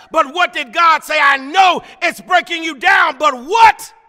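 driving, shouted refrain, building; full-force preaching. Real, not acted.

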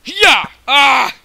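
loud, high attack cries; battle yell. Real, not acted. Gamer lunging at an opponent, loud battle yell. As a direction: let out a fighting yell. Striking in the game.

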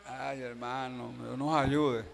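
low, slow, soft mock lament; lamento imitado. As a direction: imitate a brother groaning along wearily. Mimics a weary brother lamenting. (lamento fingido, bajo y arrastrado)

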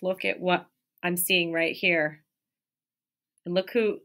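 usual speaking voice

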